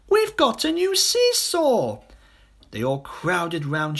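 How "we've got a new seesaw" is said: high, slow delighted cry; thrilled about a new seesaw; excited children. A narrator's reading. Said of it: "they cried".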